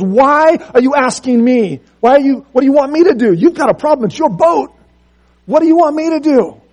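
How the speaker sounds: high, loud, incredulous questioning; exasperated retort. Acted. Taylor to the ship's captain, loud incredulous exasperation. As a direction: throw the problem back at him, baffled.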